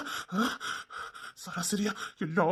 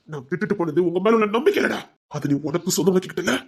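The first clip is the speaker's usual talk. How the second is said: ordinary talk, nothing marked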